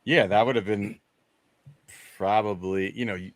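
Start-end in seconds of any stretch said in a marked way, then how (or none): none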